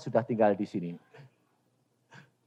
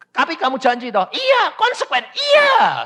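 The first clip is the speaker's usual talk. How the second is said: high, loud yelps of agreement; worked-up acted exchange; 12 semitones higher, 8 dB louder than usual. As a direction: jump way up in pitch, exasperated. Acted exchange with God over promise.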